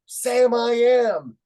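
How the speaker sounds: very loud, high, long-drawn holler; stretched-out group cheer; kids' shout. Retold or imitated. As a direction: yell it slowly like a whole class shouting along. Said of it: "the kids shouted out".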